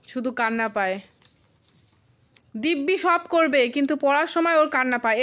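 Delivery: ordinary talk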